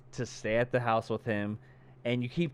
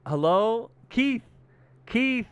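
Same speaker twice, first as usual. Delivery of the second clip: high, raised searching call; 11 semitones higher, 6 dB louder, slower than usual. Retold or imitated. Imitating a woman calling for her missing companion, calling out. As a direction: call into the dark, pausing for an answer. Heroine calls for missing man.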